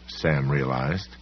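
usual speaking voice

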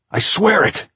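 very high, loud insistence; shouted oath. A narrator's reading. Locked-in man swears to the caretaker, shouting. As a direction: shout your oath desperately. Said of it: "Jack shouted".

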